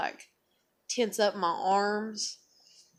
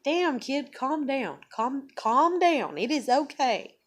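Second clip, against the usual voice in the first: higher, drawn-out calm down; exasperated mom; 7 semitones higher, 4 dB louder, slower than usual. Retold or imitated. Mother telling her flexing daughter to calm down, exasperated and higher. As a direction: soothe a tensed-up kid, exasperated. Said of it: "it's like".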